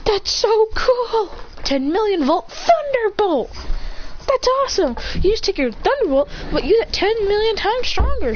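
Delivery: high-pitched gushing; hammed-up starstruck excitement; excited fan. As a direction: gush in awe with stretched high words. Deku gushes over the move.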